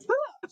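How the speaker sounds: sharp, high-pitched imitated gasp; startled gasp. Host retelling how the zoom scared the couple, startled gasp. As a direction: mimic a sudden startled intake. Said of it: "They scared you for a second?".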